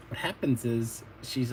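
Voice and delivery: ordinary delivery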